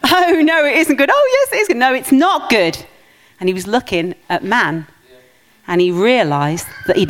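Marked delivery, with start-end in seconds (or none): 0.0-2.8 high hammed-up panto back-and-forth; panto crowd callback. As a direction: play both sides of a panto shout. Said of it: "Panto, sorry, no, it's not Christmas panto".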